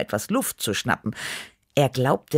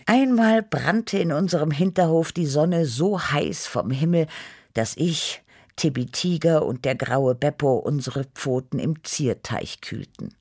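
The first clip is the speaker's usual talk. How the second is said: ordinary delivery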